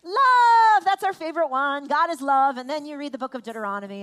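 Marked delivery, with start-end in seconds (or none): none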